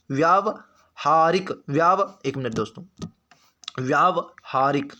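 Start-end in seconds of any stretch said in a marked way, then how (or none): 0.1-2.1 व्यावहारिक split as "vyaav... haarik"; slow syllable-by-syllable. Shown as a demonstration. Teacher answering what the word is, व्यावहारिक slowly broken up. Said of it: "तो शब्द क्या है?". 3.8-4.9 व्यावहारिक drawn out as "vyaav... haarik"; measured dictation of व्यावहारिक; slow syllable-by-syllable. Shown as a demonstration. Teacher writing it for students, व्यावहारिक said slowly in parts.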